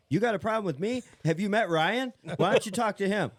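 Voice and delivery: high, loud imitated challenge; mock confrontation. Imitating Josh challenging someone at the race, loud mock confrontation.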